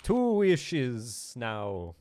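loud high cry, then slow; genie vampire voice. Acted. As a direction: snap no, then drawn-out grumble. Genie denies wasted wish.

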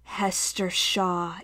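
slow, soft, low hiss of a dead machine; quiet mechanical hiss. A narrator's reading. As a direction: draw the name out like leaking gas. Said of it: "gas leak hiss of a voice".

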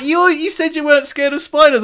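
high, loud quoted line through laughter; mock her teasing while laughing. Girlfriend teasing about spiders, laughing high. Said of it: "She's like".

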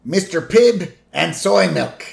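high, loud listing in a put-on voice; Patty. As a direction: call out the drinks as a cartoon aunt. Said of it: "And Patty's like".

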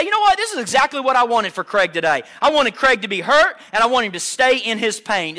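loud, high-pitched parody of an uncaring God; harsh mock God. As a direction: preach it loudly as a mock cruel father. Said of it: "Is that how God talks?".

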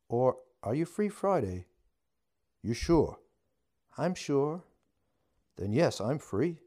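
can't hide the delight; eager and happy. A narrator's reading. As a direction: couple arranging their next date, giddy. Couple agree on a date.

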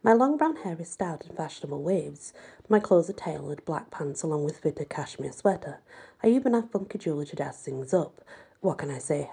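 usual speaking voice

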